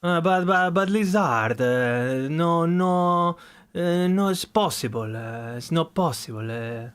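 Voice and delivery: mock Italian accent. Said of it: "The Italian accent is like".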